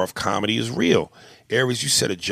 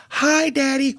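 put-on child voice. Child greets angry dad.

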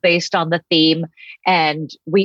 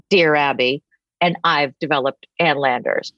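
ordinary talk, nothing marked

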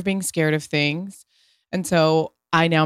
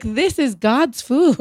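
slow, higher, reverent pronouncement; imitating Italian partner; 8 semitones higher, 3 dB louder, slower than usual. Italian partner praising pasta, slow and reverent. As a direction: declare it grandly and slowly.

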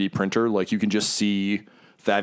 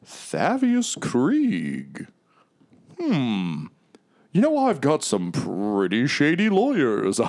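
slow, higher swooping villain voice; scheming devil; 6 semitones higher, much slower than usual. Acted. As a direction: draw it out with relish. GM voicing scheming devil Mahadi.

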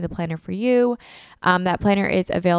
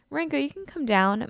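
higher, soft coaxing call to a pet; sweet higher call to a scared dog; 8 semitones higher, 4 dB quieter than usual. Real, not acted. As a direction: coax the nervous dog down, voice lifted. Calling the dog down the stairs.

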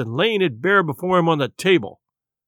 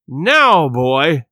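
menacing; 2 semitones lower, 5 dB louder than usual. A narrator's reading. Beggar grips the boy.

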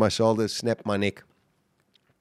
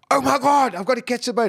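high, urgent panic; panicked thought; 14 semitones higher, 3 dB louder than usual. Retold or imitated. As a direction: blurt it out in sudden alarm. Alone in ocean, boat leaving.

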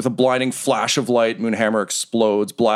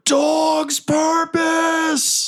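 very high, long-held cry; high, slow, hollered cry; drawn-out battle cry; much slower than usual. Acted. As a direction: stretch each word out in a high call. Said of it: "Butthole, you hear".

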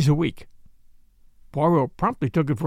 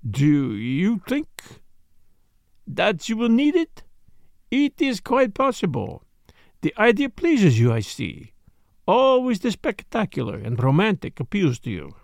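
put-on French-accented detective, higher and deliberate; Poirot, teasing his friend; 3 semitones higher than usual. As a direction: tease with measured relish in a French accent.

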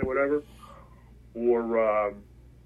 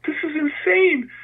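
high, slow, marvelling; stunned by the sight; amazed; 12 semitones higher, slower than usual. Retold or imitated. Teen seeing a briefcase of tickets, slow and amazed.

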